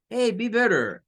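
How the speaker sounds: "Hey, be better" with a flapped T; "Hey, be better" lively, T tapped like R; casual American. Shown as a demonstration. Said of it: "díganlo como la R". ("Hey, be better" con T como R)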